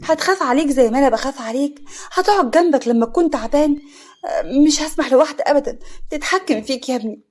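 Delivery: high, loud, possessive outburst; cutting him off. A narrator's reading. Mother won't let any woman rule her son, heated. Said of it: "فوزية قطعته".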